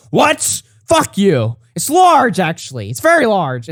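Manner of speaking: high, loud indignant protest, hammed-up; mock defensive outrage. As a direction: play a man loudly overreacting to a taunt. Acts out the man's angry retorts.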